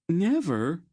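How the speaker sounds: high, loud rising question on "Never"; anguished yelp rising at the end; stricken disbelief. Acted. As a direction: echo "Never" as an aghast question. Cast-off lover, horrified echo.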